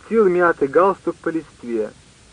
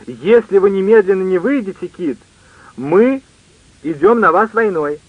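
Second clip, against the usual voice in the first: raised, emphatic threatening call; mild narrated shout; 4 semitones higher than usual. A narrator's reading. Scott threatening war on those still in the woods, restrained shout. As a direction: call out the ultimatum, holding back the volume. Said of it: "Скотт уже кричал".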